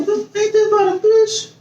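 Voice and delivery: high-pitched fed-up complaint; outraged disbelief. Retold or imitated.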